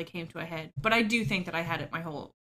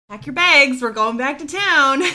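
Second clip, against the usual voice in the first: loud, higher, half-laughing declaration; retold announcement; 7 semitones higher, 14 dB louder than usual. Finally telling family they're moving back to town, loud and high. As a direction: belt it out like a decision at last.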